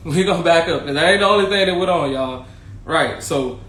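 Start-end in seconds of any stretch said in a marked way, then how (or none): 0.1-2.5 louder, higher, fast and laughing; rushing to tell the next part; excited laughing. Real, not acted. Laughing, getting worked up.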